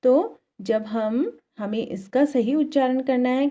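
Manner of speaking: ordinary talk, nothing marked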